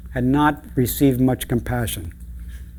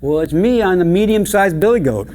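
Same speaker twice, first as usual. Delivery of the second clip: louder, higher goat voice; medium billy goat; 6 semitones higher, 6 dB louder than usual. Acted. Medium-sized goat answering the troll, loud raised voice. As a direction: answer up brightly from the bridge.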